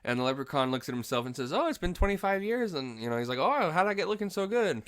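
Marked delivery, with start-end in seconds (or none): 1.5-2.7 high-pitched impish self-admiration; pitched-up character quote; mimicked leprechaun. Quoting the leprechaun admiring himself. 3.4-4.8 high-pitched impish self-admiration; pitched-up character quote; mimicked leprechaun. Quoting the leprechaun admiring himself.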